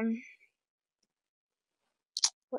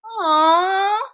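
delighted aww; 7 semitones higher, 12 dB louder than usual. Real, not acted. Reacting to a cute outfit.

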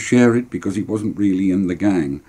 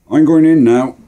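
put-on loud, higher boy's voice; announcing departure; 7 semitones higher, 8 dB louder than usual. Lewis to his mates at bedtime, calling out. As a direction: call out your goodbye.

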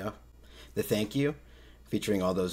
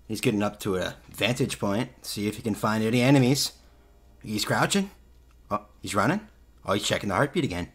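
louder, quicker play-by-play; play-by-play commentary; 2 semitones higher, 5 dB louder than usual. Pretend. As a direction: call every move like a caster. Live play-by-play of player.

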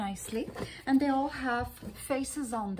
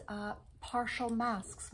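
ordinary talk, nothing marked

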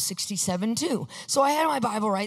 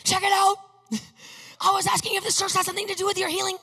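much higher, louder, rushed excited shouting; freaking out; 9 semitones higher, 4 dB louder than usual. Retold or imitated. Son bursting out to his father about the sign, shouting excitedly. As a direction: blurt it out, overwhelmed with excitement. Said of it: "Of course, I'm freaking out".

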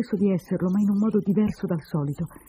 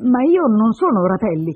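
loud, raised-pitch protest; somewhat troubled; 5 semitones higher, 10 dB louder than usual. Acted. Man denies being her husband, a bit troubled. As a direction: protest a mistaken identity, a little rattled. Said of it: "Dissi un po' turbato".

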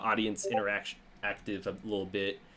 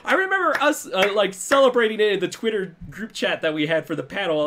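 loud and much higher, animated; excited; 8 semitones higher, 9 dB louder than usual. Real, not acted. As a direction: burst out with excitement. Excited recounting, loud and high.

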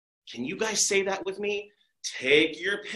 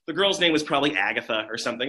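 plain reading voice

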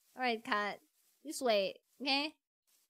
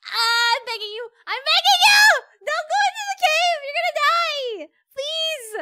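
high, very loud pleading scream; desperate begging; 10 semitones higher, 12 dB louder than usual. Real, not acted. Player begging the cat not to die, desperate screaming. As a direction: beg at the top of your voice.

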